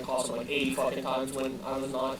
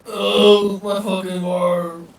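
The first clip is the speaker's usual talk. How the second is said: pained shout; 4 semitones higher, 12 dB louder, much slower than usual. Acted. Injured player cries out in pain.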